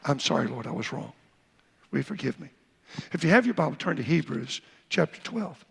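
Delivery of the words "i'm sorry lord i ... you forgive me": low, soft confession to God; understated repentant prayer; humble prayer. Shown as a demonstration. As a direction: pray quietly and humbly. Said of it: "the right example".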